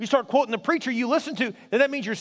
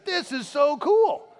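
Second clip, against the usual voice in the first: awed delight; 5 semitones higher, much slower than usual. Acted. Imagined awed reaction to bones rising.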